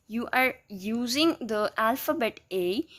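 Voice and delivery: normal talking voice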